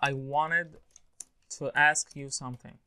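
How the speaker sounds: "wanted" said as "wanned", T dropped; native-style "wanted" with the T swallowed after the N; casual dropped T. Shown as a demonstration. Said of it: "بيقولوها".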